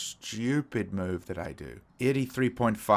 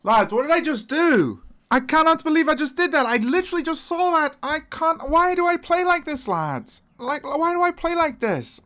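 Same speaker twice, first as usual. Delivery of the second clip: high, loud exasperated yelling at himself; real frustrated outburst at a chess blunder; 8 dB louder than usual. Real, not acted. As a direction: rant at your own blunder, near shrieking. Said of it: "I'm really frustrated".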